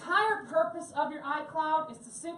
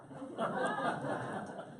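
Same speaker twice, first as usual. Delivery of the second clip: airy unvoiced laugh, higher; breathless laugh; 7 semitones higher, 6 dB quieter than usual. Real, not acted. Amused at the customer who dropped it in water, airy laugh. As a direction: laugh out at the story's punchline.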